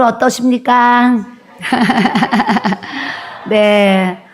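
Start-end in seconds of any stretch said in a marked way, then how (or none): 1.6-3.4 rapid string of light ha-ha laughs; amused, rippling laugh; real chuckle. Real, not acted. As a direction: laugh in a quick run that tails off. Laughs after question to congregation.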